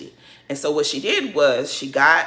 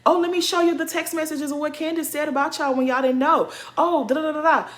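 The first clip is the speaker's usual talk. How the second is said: mimicking Monique; 7 semitones higher than usual. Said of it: "Monique comes around and was like".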